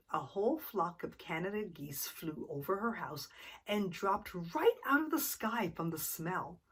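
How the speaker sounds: plain reading voice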